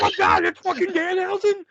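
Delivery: high, stunned disbelief; shocked. Retold or imitated. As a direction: gasp it out, amazed. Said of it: "And I was like".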